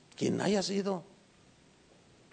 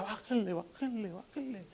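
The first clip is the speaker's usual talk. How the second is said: urgent hush, each bájenle softer than the last; hushing fading; 4 semitones higher, 12 dB quieter than usual. Retold or imitated. Missionary hushing the hidden congregation, fading hush. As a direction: shush the group down to nothing. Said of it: "de los misioneros decía".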